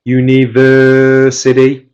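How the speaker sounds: "university" with "ver" held long on a level tone; drawn-out "ver" in "university", no punch; longer stress only. Shown as a demonstration. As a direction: stretch "ver" in "university", keeping pitch and volume even. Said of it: "without getting louder and higher".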